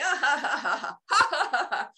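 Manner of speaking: high, rolling laughter-yoga laugh; exaggerated exercise laugh; demonstrated laughter. Shown as a demonstration. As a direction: laugh deliberately in a long run of ha-has. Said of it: "I'm laughing".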